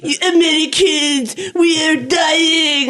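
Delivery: hammed-up European lament, dragging out the words; butthurt European. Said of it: "some butthurt European being like".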